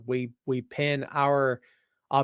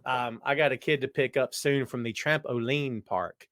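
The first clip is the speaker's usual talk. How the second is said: normal talking voice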